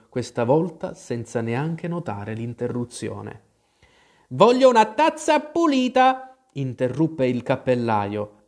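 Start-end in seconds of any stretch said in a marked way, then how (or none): 4.3-6.4 very high, loud interruption; high-pitched storybook demand; demanding Hatter. A narrator's reading. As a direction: cut in shrieky and insistent.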